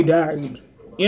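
muffled behind fist; speaking through hand. Real, not acted. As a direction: mouth covered by hand. Seen 1: Fist pressed over mouth from 1.4 to 2.3 s while speaking.

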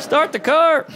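high, laughing panic; high laughing yelp to flee; panicked getaway plea. Acted. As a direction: squeak it out, laughing, get us out of here.

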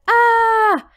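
high loud yelp at the stink; piercing ah; loud shocked cry. A narrator's reading. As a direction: cry out as the stench hits. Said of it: "He said".

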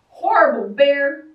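loud angry cry of horrible bear; shouted. A narrator's reading. Girl shouting at the bear who broke her kite. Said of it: "the girl shouted".

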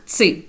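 "ц" as a crisp t plus s; voiceless ts sound. Shown as a demonstration. Teacher showing the other reading of zeta, voiceless "ц". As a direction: say "ц" without any voicing. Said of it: "а інколи як звук".

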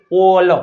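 ઓલ with a long rounded 'o'; sounded out. Shown as a demonstration. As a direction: stretch ઓલ slowly, then release the L. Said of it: "આમ નો થાય એલ". (ઓલ લંબાવીને)

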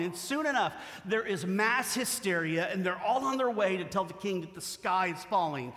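ordinary delivery

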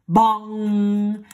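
loud booming bong; clock chime. A narrator's reading. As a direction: ring it out like a clock bell. Clock striking in story.

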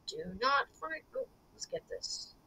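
breathy hushed murmur; whispering while hiding. Real, not acted. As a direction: whisper so the guard can't hear. Hiding from the guard.

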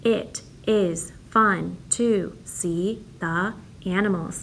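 very slow, word by word; slow counting read. Shown as a demonstration. Teacher reads slowly so the class counts the words. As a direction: stretch it so each word can be counted. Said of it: "Here's my next sentence".

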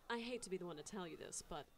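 soft playful character voice, lightly done; a hint of Moxie teasing. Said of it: "in your character voice".

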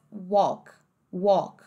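"walk" with an open aw, silent L; American open vowel. Shown as a demonstration. Teacher showing the American word, open-vowel "walk". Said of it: "no inglês americano".

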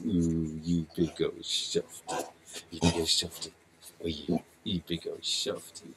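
low, soft doting coo; soft pet talk. Real, not acted. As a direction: croon it fondly to the dog. Cooing to the dog.

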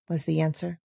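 usual speaking voice

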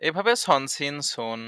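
ordinary talk

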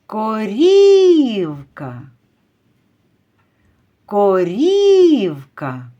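корівка called loud with a very long І; calling out. Shown as a demonstration. Teacher calls the cow to find the stress, drawing out корівка's І. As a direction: stretch the middle syllable of корівка in a slow call. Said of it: "Покличемо корівку".

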